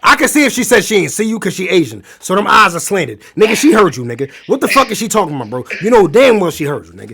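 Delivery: loud, high and disbelieving, piling it on; loud incredulous rant. Real, not acted. Host reacting to the guest's bar story, loud and incredulous. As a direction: call out the obvious lie at full volume. Host scoffs at girlfriend's excuse.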